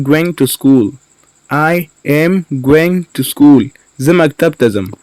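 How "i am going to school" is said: slow, separated words for learners. Shown as a demonstration. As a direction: say "I am going to school" slowly, words apart. Teacher repeats word by word.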